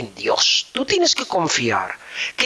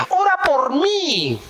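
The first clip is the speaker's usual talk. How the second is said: mock request; 12 semitones higher, slower than usual. Mimicking people asking prayer.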